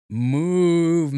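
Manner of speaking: loud, long, high-pitched moo; drawn-out imitation mooing; mock cow moo. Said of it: "started making mad moon sounds".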